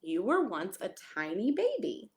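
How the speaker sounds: ordinary delivery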